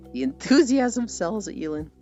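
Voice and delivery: high, loud burst on ENTHUSIASM; mock enthusiasm. Streamer repeating a chat message to Yulin, with mock enthusiasm. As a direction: punch out "enthusiasm" high and loud, then ease off.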